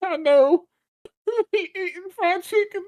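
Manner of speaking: high, mock voice of the kid; kid's line in a high put-on voice; imitating the kid. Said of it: "he said".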